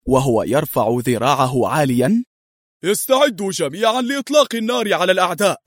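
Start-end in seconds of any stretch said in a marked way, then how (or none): 2.8-5.6 higher-pitched military command; war commander's cry. A narrator's reading. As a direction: order the troops to open fire like a war leader. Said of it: "وصرخ في لهجة قائد حربي". (صرخة قائد حربي يأمر جنوده)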